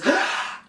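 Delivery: big audible gasp of breath; demo huge gasp. Shown as a demonstration. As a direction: suck in a huge breath before the line. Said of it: "take a huge breath".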